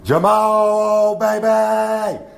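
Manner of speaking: put-on screaming Jamal baby in delight; screamed cheer. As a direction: shriek his name from the stands. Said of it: "I screamed and I said".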